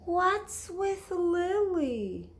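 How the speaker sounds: high, slow, wondering mom voice; slow puzzled mom; puzzled mother. Acted. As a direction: ask it like a baffled mother.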